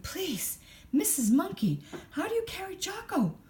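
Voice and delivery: high, hurried little squeak; breathless squeak. A narrator's reading. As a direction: squeak it out, short of breath after running. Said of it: "she managed to squeak".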